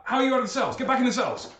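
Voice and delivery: high-pitched, stammering guard's shout; alarmed guards. Acted. Guards to escaped prisoners on the stairs, alarmed high shout. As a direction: yell orders like a flustered guard. Game master voices startled guards.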